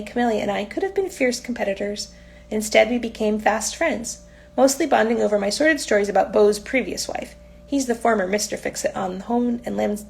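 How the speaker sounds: plain reading voice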